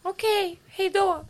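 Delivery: very high little goodbye; put-on high, compliant sign-off; mock polite retreat. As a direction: act out giving up instantly, high-pitched. Acts own instant polite retreat.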